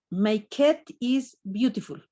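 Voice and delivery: "My cat is beautiful" spelled out very slowly, falling on "cat"; slow dictation. Shown as a demonstration. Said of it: "Les digo".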